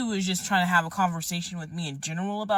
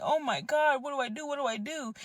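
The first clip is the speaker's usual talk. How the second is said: higher panicky questions; panicked self; 8 semitones higher than usual. Retold or imitated. Vlogger asking what to do, higher and panicky. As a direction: ask in raised panic. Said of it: "And I'm like".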